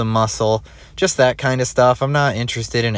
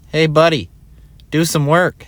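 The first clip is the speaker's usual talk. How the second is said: higher, louder teasing call; heckling call-out; 6 semitones higher, 4 dB louder than usual. Pretend. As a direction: call out to a lazy gym buddy. Heckling himself on screen.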